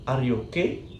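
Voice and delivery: "okay" lifts at the end; closed question, pitch climbing on "okay"; rising question intonation. Shown as a demonstration. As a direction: finish "okay" on an upward glide. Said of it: "a rise at the end".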